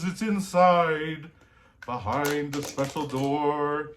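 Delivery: booming, drawn-out jolly Santa; big-hearted Santa introducing a story; jolly Santa host. Acted. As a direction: welcome little listeners as a hearty Santa. Santa hosts the story hour.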